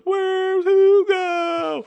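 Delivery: very high, stretched mock whine; high drawn-out squeak mocking Hollywood. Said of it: "And Hollywood goes".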